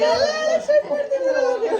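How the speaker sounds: high, loud wail breaking into laughter; laughing mock protest. Vlogger teases aunt advising the bride, laughing mock protest. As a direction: protest in playful exaggeration, laughing. Teasing protest at aunt's advice.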